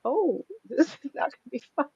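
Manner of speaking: high, soft, laughing dread at a hard session; a rueful laugh through a worried thought; dismayed inner thought, laughing. Retold or imitated. To himself, facing a weeping client: soft laughing dread. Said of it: "And I went".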